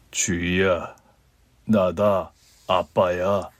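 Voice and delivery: low, slow, halting; a father unsure he may even say her name; hesitant old father. A narrator's reading. Estranged father calling his daughter, low, slow and hesitant.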